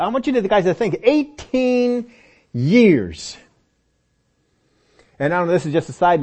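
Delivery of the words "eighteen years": "eighteen" high and stretched, "years" dropping after a pause; drawn-out emphasis. Real, not acted. Urging them to ponder the length.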